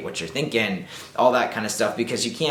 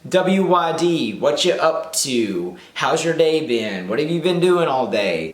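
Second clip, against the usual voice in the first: mocking; 7 semitones higher, 5 dB louder, slower than usual. Mocking boring opener lines.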